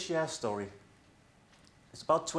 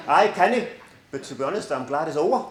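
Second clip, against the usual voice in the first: put-on Geordie accent; 3 semitones higher, 11 dB louder than usual. Colleague Brian after Christmas in a hammed-up Geordie accent. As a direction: have another go at Geordie, bigger and louder. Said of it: "Well, I tried".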